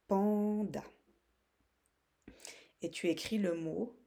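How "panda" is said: "panda" in two beats, long first syllable. Shown as a demonstration. Teacher showing the two syllables, syllable by syllable. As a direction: stretch "pan", then add "da". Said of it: "les deux syllabes de panda".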